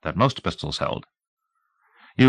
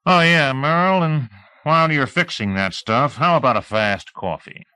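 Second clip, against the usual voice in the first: lightly voiced sneering sheriff, a bit higher; a hint of a smug lawman warning a drifter; small-town sheriff, mocking; 7 semitones higher than usual. Sheriff needling the drifter he's driving out of town, lightly voiced mock politeness.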